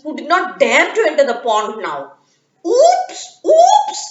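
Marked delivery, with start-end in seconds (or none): 2.6-4.0 very high, louder giggly oops; laughing oops. Acted. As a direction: giggle it out like a cheeky otter. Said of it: "said the otter".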